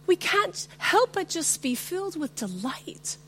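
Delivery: normal talking voice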